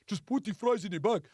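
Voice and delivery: imitation Nigerian accent; put-on Nigerian accent, brief order.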